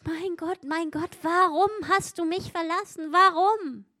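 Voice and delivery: Jesus crying from the cross, anguished. Acted. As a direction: cry out in agony to God.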